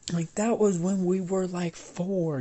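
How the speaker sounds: acted exasperated outburst; indignant comeback. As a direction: protest loudly as in a squabble. Acted comeback in the childhood quarrel.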